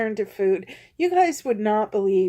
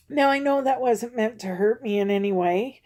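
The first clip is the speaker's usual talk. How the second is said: normal talking voice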